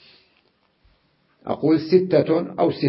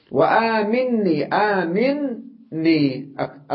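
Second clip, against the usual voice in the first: آمني drawn out with the ن doubled; slow doubled nun; 6 semitones higher, much slower than usual. Shown as a demonstration. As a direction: linger on the نّ of آمني. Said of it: "ألفظها نونان". (آمني ببطء مع تشديد النون)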